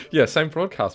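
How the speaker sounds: talking through laughter; laughing. Real, not acted. Laughing at frozen computer joke.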